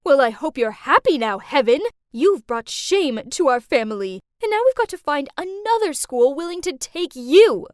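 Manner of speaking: loud, scolding mom's outburst; angry yelling. A narrator's reading. Mom yelling at her expelled daughter, angry scolding. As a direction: lay into your daughter at full voice. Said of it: "my mom yelled at me".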